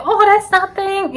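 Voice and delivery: high, airy brush-off; voicing her hookup waving it away, high and airy; breezy dismissal.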